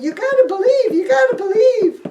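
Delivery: high whiny pleading; whining disciples. Acted. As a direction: whine and beg him to believe. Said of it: "The disciples kept whining".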